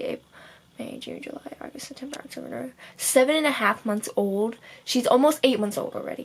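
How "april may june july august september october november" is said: whispered month count; soft unvoiced tally; counting under breath. Real, not acted. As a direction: mouth the months as you count.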